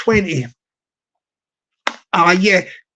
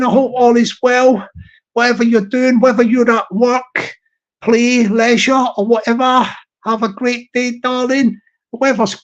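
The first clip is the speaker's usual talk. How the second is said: usual speaking voice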